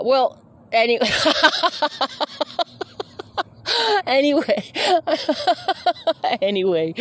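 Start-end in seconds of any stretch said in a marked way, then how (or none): 1.1-3.4 long high-pitched giggle; real laughter bubbling over; high giggling fit. Real, not acted. Cracks up after "any. 4.7-6.4 long high-pitched giggle; real laughter bubbling over; high giggling fit. Real, not acted. Keeps laughing between "anyway"s.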